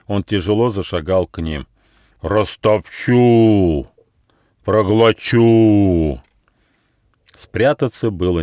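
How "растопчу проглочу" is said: slow, loud giant's roar; menacing giant. A narrator's reading. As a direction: drag out each threat hugely. Giant threatens the travellers.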